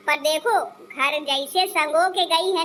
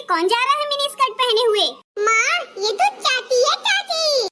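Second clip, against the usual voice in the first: put-on little child voice; 6 semitones higher than usual. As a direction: play a small child giggling at aunty's short dress. Child spots the aunt. (छोटे बच्चे की ऊँची आवाज़)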